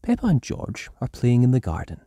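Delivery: plain reading voice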